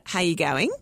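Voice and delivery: Australian greeting with a rising end; Australian accent. Retold or imitated. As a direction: mimic a chirpy Australian "how you going". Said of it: "they're like".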